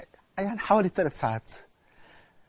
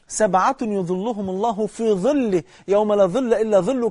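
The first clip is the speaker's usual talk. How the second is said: solemn hadith quote; 3 semitones higher than usual. Acted.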